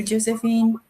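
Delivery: normal talking voice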